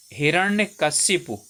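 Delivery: हिरण्यकशिपु said slowly, syllable by syllable; careful correct form. Shown as a demonstration. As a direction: spell out हिरण्यकशिपु slowly and clearly. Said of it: "ये वाला सही है".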